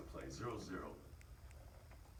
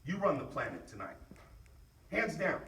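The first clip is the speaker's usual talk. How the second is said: pep talk; 9 semitones higher, 7 dB louder than usual. Real, not acted. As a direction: push them to want it. Pep talk calming then rising.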